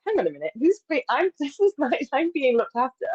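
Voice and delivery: as if caught off guard by kindness; delighted surprise with a laugh; amused surprise. Retold or imitated. Her surprised reaction to care.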